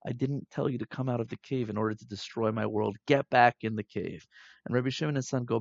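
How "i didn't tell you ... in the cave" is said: normal talking voice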